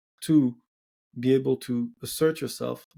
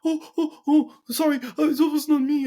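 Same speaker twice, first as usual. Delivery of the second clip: much higher, louder mock-whiny woman's protest; mocking falsetto; 12 semitones higher, 4 dB louder than usual. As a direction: squeal it like a flustered girl backing off. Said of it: "mocking her protest".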